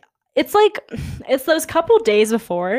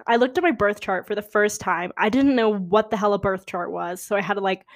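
normal talking voice

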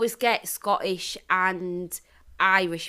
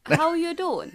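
high, laughing mock Welsh; Welsh accent; 9 semitones higher, slower than usual. Shown as a demonstration. Copying partner's Gavin and Stacey line, Welsh accent. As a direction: echo it in sing-song Welsh. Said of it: "Gavin and Stacey".

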